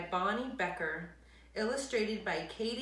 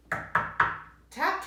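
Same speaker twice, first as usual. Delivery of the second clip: tapping sound; 10 semitones higher, 6 dB louder than usual. Acted. Knock sound voiced.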